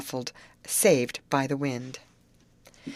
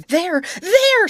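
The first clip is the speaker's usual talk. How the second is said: much higher and louder, a panicked scream; 10 dB louder than usual. A narrator's reading. Grace spotting a real alligator, screaming in terror. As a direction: shriek and point in terror. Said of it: "screamed Grace".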